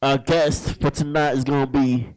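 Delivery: announcer voice. Acted. Host opens the live show.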